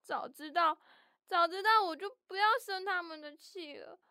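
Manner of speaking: acted crying while regretting a quarrel; tearful regret. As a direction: let the regret build into crying. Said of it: "别哭了".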